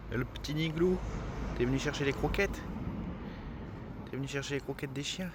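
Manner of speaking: soft high coaxing; sweet talk to a small animal. Real, not acted. Talking sweetly to a hedgehog.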